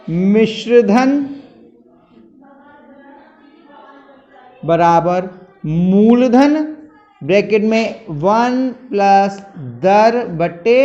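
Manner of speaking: slow, word by word for copying down; slow dictation. Shown as a demonstration. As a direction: dictate the formula slowly so students can write it. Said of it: "मिश्रधन लिख दे रहे हैं". (धीरे-धीरे लिखवाते हुए)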